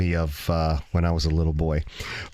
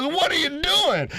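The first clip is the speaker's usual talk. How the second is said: very high-pitched startled outcry; alarmed, comic high yelp; startled uncle. Retold or imitated. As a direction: play a man jolted off the sofa. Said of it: "He jumped off the sofa and says".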